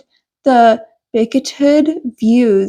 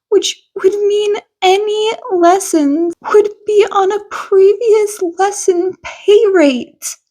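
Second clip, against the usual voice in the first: higher, troubled mother's voice; a mother fretting over her son's lessons; lightly voiced worried mother explaining; 7 semitones higher than usual. A narrator's reading. Inko voiced, upset.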